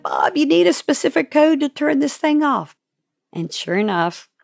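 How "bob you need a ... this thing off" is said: urgent. Retold or imitated. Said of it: "And I'm like".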